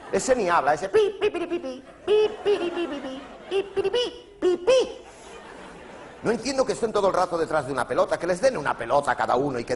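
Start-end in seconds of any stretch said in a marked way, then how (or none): 0.7-5.0 very high squeaky beeping. Retold or imitated. Comedian imitating a player who only squeaks, very high beeps. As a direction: peep like a toy. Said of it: "otro que va de pitorreo".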